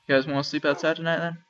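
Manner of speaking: usual speaking voice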